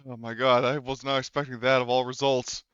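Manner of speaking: ordinary talk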